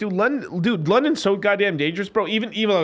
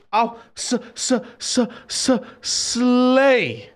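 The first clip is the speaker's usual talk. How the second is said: high, stuttered build-up to a drawn-out slay; hyped-up mock stammer; playful stutter; 7 semitones higher, much slower than usual. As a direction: stutter on the s, then burst into the word.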